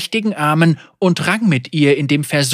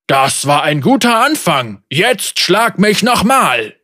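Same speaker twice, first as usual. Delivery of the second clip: eager giant taunt; 3 semitones higher, 4 dB louder than usual. A narrator's reading. Giant eggs monster on.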